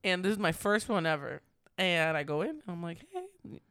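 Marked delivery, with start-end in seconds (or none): 3.1-3.6 sudden high thin crack on the greeting. Retold or imitated. Retelling her first write-up, voice cracking on hello. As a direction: voice giving out as she begins. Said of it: "Oh, like, just like that. I lose my voice".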